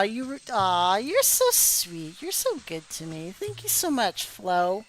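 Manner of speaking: normal talking voice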